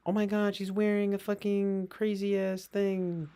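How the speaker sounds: high, loud put-on amazement; hammed-up gawking; mock shock. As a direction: imitate a scandalized onlooker, drawn out. Imitates people shocked at her outfits.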